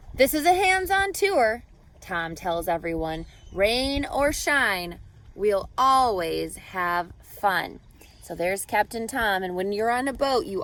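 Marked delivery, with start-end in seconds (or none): 0.1-1.6 higher and louder, a booming tour guide; hearty captain. Acted. Captain Tom welcoming everyone aboard, hearty and loud. 3.5-7.8 slow and deliberate, each word spread out; slow captain's promise. Acted. Captain Tom promising the tourists fun, slow and steady.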